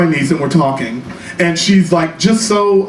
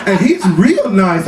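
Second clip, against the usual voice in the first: niece voice; 6 semitones higher than usual. Retold or imitated. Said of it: "She's like".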